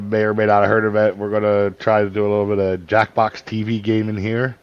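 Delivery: ordinary talk, nothing marked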